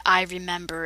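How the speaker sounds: understated sorrow, voice dropping; sad. A narrator's reading. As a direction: read it softly with mild sadness. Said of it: "Siak was sad and said".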